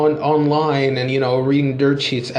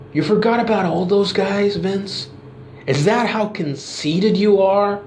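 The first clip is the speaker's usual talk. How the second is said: angry promo; 4 semitones higher than usual. Retold or imitated. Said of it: "you got Rock cutting a promo and says".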